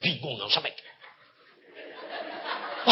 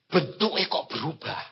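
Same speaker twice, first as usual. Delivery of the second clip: ordinary talk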